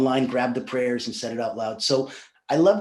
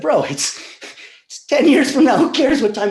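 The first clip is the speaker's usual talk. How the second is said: Who cares what time? burst out much higher and louder; incredulous clerk; 12 semitones higher, 8 dB louder than usual. Retold or imitated. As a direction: scoff at the silly question, loud and high. Clerk scoffs in Russian joke.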